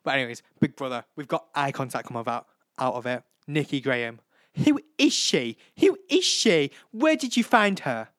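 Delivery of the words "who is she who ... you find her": much higher, louder mock outrage; high, loud diva impression; impression of a reality star. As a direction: sneer at the newcomer loudly. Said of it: "that was a really good impression".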